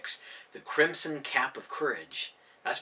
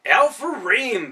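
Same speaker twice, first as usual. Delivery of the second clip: slow, loud, higher impatient correction; 9 semitones higher, 10 dB louder, much slower than usual. A narrator's reading. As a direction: spell the name out for him, fed up. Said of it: "growing impatient".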